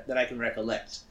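ordinary talk, nothing marked

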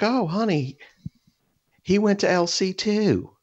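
high, slow southern drawl, imitating the neighbor's wife; mock southern belle calling to her husband. Neighbor's wife telling her husband, in a mocking southern drawl. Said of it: "southern fucking irritating drawl".

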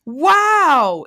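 mock educational cartoon host; Dora. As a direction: ask the viewers slowly, then burst into praise. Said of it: "Just like Dora".

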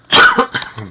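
a sharp dry cough; real cough. Real, not acted. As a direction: cough once, hoarse. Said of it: "desculpa a tosse aí". (tosse seca)

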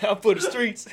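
laughing. Retold or imitated. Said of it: "A huh?".

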